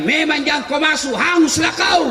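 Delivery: high-pitched told-you-so; mock scolding. Villager seeing someone leave the lift, high mock scolding. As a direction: imitate a villager's exclaimed warning, pitched high.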